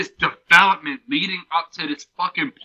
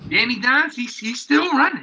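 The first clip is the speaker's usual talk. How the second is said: high, fired-up hype; rising with excitement; excited; 9 semitones higher than usual. Real, not acted. Excited football comparison.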